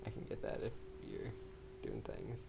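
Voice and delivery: soft whisper. Real, not acted. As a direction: whisper the offer. Quiet offer, unvoiced.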